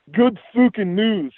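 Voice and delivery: slow, high, hammed-up Scottish mumble; relieved slow Scottish mumble, pitched high; mumbled Scottish brogue. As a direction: mutter it to himself in the fake Scottish voice. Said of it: "he mumbled".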